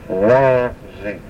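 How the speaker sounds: WA-zi, Wazi with the accent up front; stress on first syllable. Shown as a demonstration. Teacher showing how Wazi is pronounced, first syllable of Wazi stressed. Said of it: "Accent is on the first syllable".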